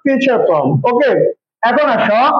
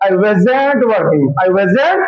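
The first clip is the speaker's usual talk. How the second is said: slow model sentence; 3 semitones lower, much slower than usual. Shown as a demonstration. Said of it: "কি হবে?".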